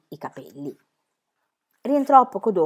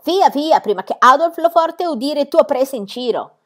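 higher, louder broken-Italian shooing; angry foreign director; 7 semitones higher, 6 dB louder than usual. A narrator's reading. Circus director sends Pippi away, angry. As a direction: wave her away angrily.

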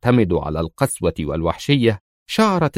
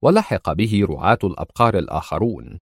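ordinary delivery

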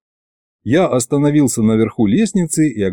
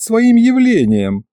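higher, slow and pompous; drawn-out imp; 11 semitones higher, slower than usual. A narrator's reading. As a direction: stretch the words out importantly. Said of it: "передразнил я".

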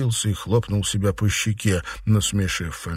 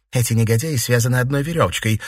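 angry, raised quick remark; angered; 5 semitones higher, 4 dB louder, faster than usual. A narrator's reading. Mistress denouncing her scheming servants, angered. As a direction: say it crossly and fast, a little higher. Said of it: "рассердилась Фэнзе".

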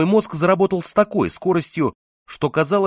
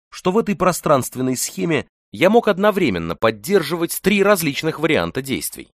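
plain reading voice

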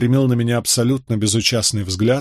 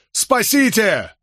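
very high, slow desperate scream of Спасите; drawn-out scream; 11 semitones higher, much slower than usual. A narrator's reading. Condemned man screams for rescue once more. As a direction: stretch Спасите into a long wail. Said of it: "заорал во всю глотку".

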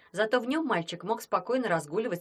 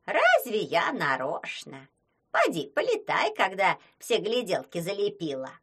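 defensive Baba Yaga; 4 dB louder than usual. Acted. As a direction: plead like a flustered old witch. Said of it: "оправдывалась Баба-Яга".

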